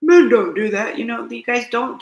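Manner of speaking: loud gruff dismissive quote of other men; imitating men shutting down emotion, loud; mock macho scolding. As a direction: bark it like a disapproving tough guy. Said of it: "they're like".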